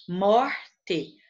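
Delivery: "morte" slowly in two parts, mor, te; "morte" as two separate syllables; split into syllables. Shown as a demonstration. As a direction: break "morte" after a stressed "mor". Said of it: "Qual que é mais forte?".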